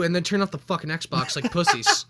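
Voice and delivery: ordinary talk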